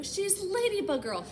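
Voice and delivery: ordinary delivery, nothing marked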